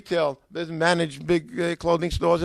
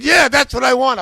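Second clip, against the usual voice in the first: high, loud relieved yes; eager agreement; 8 semitones higher, 9 dB louder than usual. Retold or imitated. Customer confirming to the bookseller, loudly eager. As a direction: jump on it eagerly.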